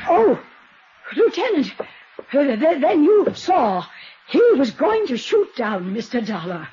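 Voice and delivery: high, stammering, caught out; flustered panic. Acted. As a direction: stumble over the words in alarm. Flustered as lieutenant arrives.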